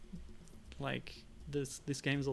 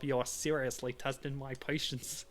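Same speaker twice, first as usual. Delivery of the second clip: loud, slow Brooklyn tough; bad Brooklyn accent; 5 dB louder than usual. Player voicing a thug warning his captive, badly faked Brooklyn accent. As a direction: lean hard on a hammy Brooklyn accent. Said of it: "uh, Brooklyn, Brooklyn".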